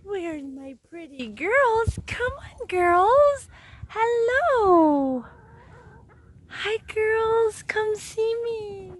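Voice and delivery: high singsong call to animals; calling pets. Real, not acted. Chicken owner calling her girls over, sweetly singsong. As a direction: coo and call them in, stretched and high-pitched.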